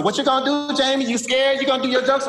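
put-on high taunting girl voice; sassy little girl. Little girl in front row taunts comedian, high mocking voice.

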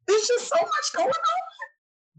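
very high, slow, wobbly whine; hammed-up weepy voice of someone overwhelmed; mock tearful wail. Telling her aunt why she cries, in a hammed-up weepy wail. Quoting herself tearing up.

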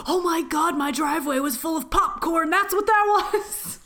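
high, laughing mock neighbor. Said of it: "and he's like".

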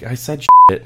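just blurt it out; blurted admission. Retold or imitated. Son admits loudly.

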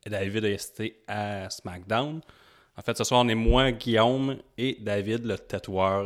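ordinary talk, nothing marked

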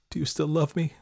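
high, tearful asking; tearful question. A narrator's reading. Girl phoning faraway boyfriend, tearful question. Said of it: "Tears rolled down her cheeks".

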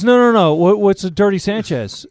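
high, loud demand for an answer; imitated insistent questioning. Producer pressing actor to explain, insistent. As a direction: cut in and press hard, retold.

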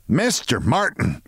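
plain reading voice

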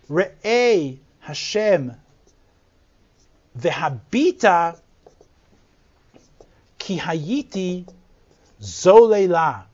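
each word drawn out with long pauses between; slow emphatic plea. Shown as a demonstration. Teacher reading the verse slowly to show its emphasis. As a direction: plead slowly, leaning on every word. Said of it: "it's a little more emphatic now".

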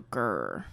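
low growl. Retold or imitated. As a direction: growl like an angry dog. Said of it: "she'd go".

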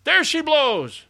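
high, loud, drawn-out cry; deprecatory lookout's call. A narrator's reading. Lookout answering the captain's scolding, deprecatory high call. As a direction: sing out the sighting to the captain. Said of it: "said the man in a deprecatory tone".

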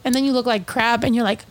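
ordinary talk, nothing marked

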